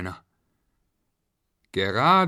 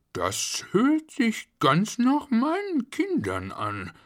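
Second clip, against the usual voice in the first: slow, high, drawn-out voice; puzzled realisation; 13 semitones higher, slower than usual. A narrator's reading. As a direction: work it out slowly and high. Father recognises his children's cries.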